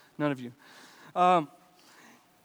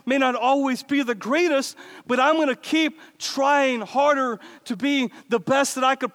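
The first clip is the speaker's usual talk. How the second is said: fired-up preaching; 4 semitones higher than usual. Acted. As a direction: preach it with conviction, building. Preaches with rising force.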